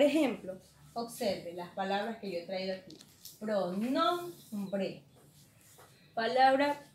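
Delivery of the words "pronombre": "Pronombre" drawn out syllable by syllable, NOM raised; teacher's slow "Pronombre", peak on NOM; stress on NOM. Shown as a demonstration. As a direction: stretch "Pronombre" and lean on the middle syllable. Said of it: "la que está aquí en medio".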